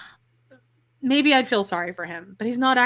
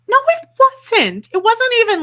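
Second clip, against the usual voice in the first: indignant protest; 12 semitones higher, 9 dB louder than usual. Real, not acted. Podcaster rebutting Robin's apology, loud indignant protest.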